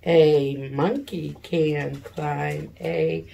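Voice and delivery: slow and loud, word by word; teacher reading aloud word by word; slow read-along. Shown as a demonstration. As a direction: read each word slowly so children can follow. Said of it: "you point under the words while I point above".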